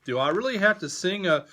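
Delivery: hammed-up complaint; mock protest. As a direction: whine the protest quickly.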